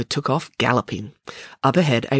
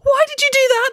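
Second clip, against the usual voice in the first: very high, loud outburst; outraged demand; 6 dB louder than usual. Acted. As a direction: demand it in a high, furious yell. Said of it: "demanded Maya".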